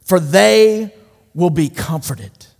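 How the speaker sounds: slow, weighty and deliberate; solemn, slow quote. Retold or imitated. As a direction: let each word of comfort land. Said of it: "No, he says".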